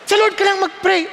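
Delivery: ordinary talk, nothing marked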